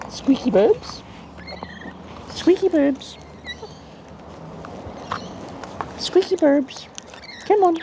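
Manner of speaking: very high squeaky pet voice; high falsetto coaxing; coaxing a bird. Real, not acted.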